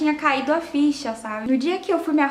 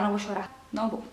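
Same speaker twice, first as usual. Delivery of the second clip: softer, fighting the tears; real lump in the throat; holding back tears; 3 semitones lower, 9 dB quieter, much faster than usual. Real, not acted. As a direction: talk yourself out of crying, quietly. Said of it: "dá até vontade de chorar".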